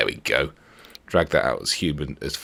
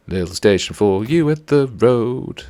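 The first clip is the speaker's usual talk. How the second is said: put-on voice; 7 semitones higher, 3 dB louder, slower than usual. Talking to the tram in a voice.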